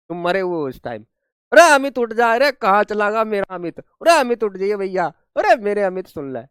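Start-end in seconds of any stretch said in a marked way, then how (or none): 1.5-6.4 loud, hammed-up wail over the body; mock wailing. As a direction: pretend to mourn, calling him to wake. Mock mourning over pretend-dead student. (नकली रोना-पुकारना, अमित को जगाते हुए)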